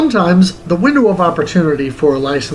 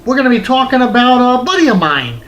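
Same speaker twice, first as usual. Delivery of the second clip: higher, upbeat TV host voice; host greeting viewers in a higher voice; show host intro; 8 semitones higher than usual. Acted. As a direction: announce the show like a host opening an episode.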